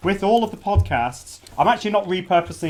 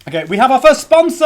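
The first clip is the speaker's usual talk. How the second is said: loud, high big reveal; excited announcement; 8 semitones higher, 9 dB louder, faster than usual. Real, not acted. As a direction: announce it with a fanfare. Big announcement after drumroll.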